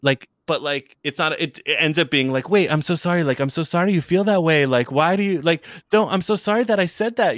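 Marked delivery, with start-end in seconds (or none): 2.5-7.4 higher-pitched, lightly acted apology; mock apologetic. Retelling a cute couple's prank videos, mock apologetic. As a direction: imitate a couple pleading sorry.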